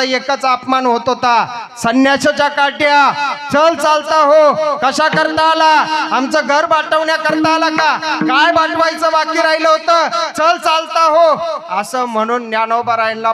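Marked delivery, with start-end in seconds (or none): 1.8-11.4 put-on angry villager shouting at a boy begging alms; contemptuous householder. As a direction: spit the insults at the beggar and drive him off. Villagers insult and drive him away.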